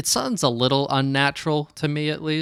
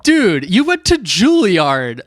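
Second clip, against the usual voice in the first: high, loud, drawn-out disbelief; retold incredulity; 7 semitones higher, 8 dB louder, slower than usual. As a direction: call out an impressed friend's absurd modesty. Retelling own disbelief at Juilliard graduate.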